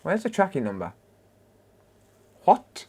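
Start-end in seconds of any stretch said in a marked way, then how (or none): 2.4-2.8 a sudden high startled "What?"; surprised what. Real, not acted. Checking an order online, suddenly surprised. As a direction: caught off guard, blurting it out high. Surprised while searching for tracking.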